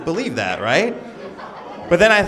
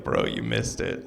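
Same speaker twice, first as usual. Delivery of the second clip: much lower, whispered and slow; hushed bro voice; 14 semitones lower, 7 dB quieter, slower than usual. Retold or imitated. Churchgoer telling Matt what he missed, in a low whisper. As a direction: lean in and breathe it like gossip. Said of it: "Be like".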